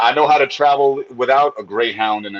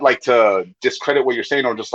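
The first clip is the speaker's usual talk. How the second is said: ordinary talk, nothing marked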